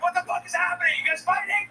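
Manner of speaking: high, panicked dog asking; alarmed dog voice. Retold or imitated. Dog hearing its owners, voiced in high alarm. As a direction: play the confused dog. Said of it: "He's like".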